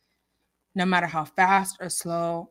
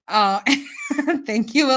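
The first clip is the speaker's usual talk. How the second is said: ordinary talk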